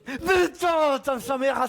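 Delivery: loud yelp of pain at the shock; pained outburst. Real, not acted. Streamer shocked by the taser, loud pained yelp. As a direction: cry out, half laughing, as it stings. Gets tased, cries out.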